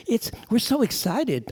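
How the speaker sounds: high, squealy mock excitement, slowed down; imitating excited wife. A man imitating his excited wife on a phone call. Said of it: "She's excited".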